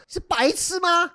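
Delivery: high, slow sneering taunt in a put-on voice; mocking jerk impression. Friend imitating Keqiang taunting during board game, drawn-out sneer. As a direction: stretch out the insult like a mocking gamer. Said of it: "我可以还原一下当时".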